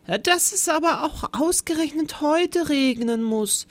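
high, worried little-man voice; sighing lament. A narrator's reading. New teacher fretting about the rain, high sighing lament. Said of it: "seufzte er".

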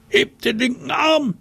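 hoher, lauter Befehlsruf; gerufen. Acted. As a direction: ruf es über die Lichtung. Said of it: "Da rief einer". (hoher Befehl an alle Waldschrate)

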